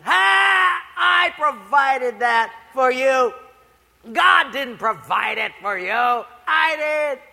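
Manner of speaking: loud, high mocking ha; triumphant gotcha shout. Atheist neighbor jumping from the bushes, gloating loudly. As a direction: jump out and gloat.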